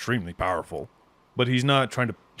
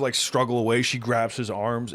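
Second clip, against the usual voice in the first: normal talking voice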